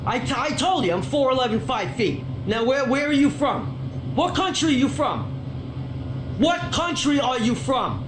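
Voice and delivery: high-pitched, heated tirade; real indignant interrogation; pissed off. Real, not acted. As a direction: press angrily for an answer. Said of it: "That pisses me off".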